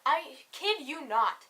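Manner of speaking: ordinary delivery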